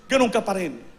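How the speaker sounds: ordinary talk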